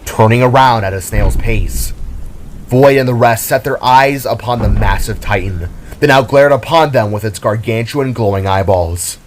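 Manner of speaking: usual speaking voice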